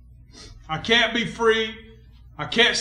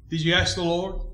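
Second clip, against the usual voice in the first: ordinary talk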